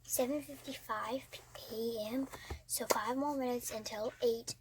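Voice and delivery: quiet unvoiced whisper; hushed morning whisper. Real, not acted. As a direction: whisper so no one wakes. Whispering in bed.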